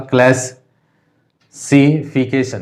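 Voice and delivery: Classification slowly, with class joined as one opening chunk; teacher regrouping the syllables of classification; syllable grouping. Shown as a demonstration. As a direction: pause after the first chunk of classification, then finish it. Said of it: "ये एक साथ होगा".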